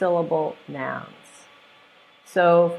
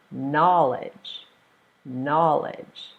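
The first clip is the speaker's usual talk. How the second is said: KNOWLEDGE with a long, strong KNOW; knowledge, stressed up front and falling; first-syllable stress; much slower than usual. Shown as a demonstration. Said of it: "you stress the first syllable".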